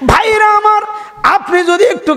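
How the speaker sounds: high, held cry to the congregation; calling out. Real, not acted. Preacher hails his brothers in the crowd, calling out. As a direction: call out to your brothers at a high pitch.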